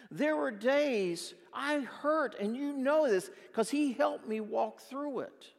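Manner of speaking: loud, slow, impassioned pleading; preaching at full force. Retold or imitated. Telling Todd about his worst days, loud and impassioned. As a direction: pour out the hard days to a friend.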